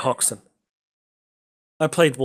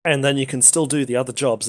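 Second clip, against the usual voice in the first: ordinary delivery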